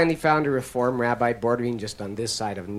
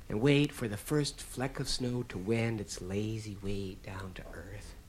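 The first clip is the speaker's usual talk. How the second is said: hushed whisper; 3 semitones lower, 7 dB quieter than usual. Retold or imitated. Storyteller whispering while describing his father watching the snow.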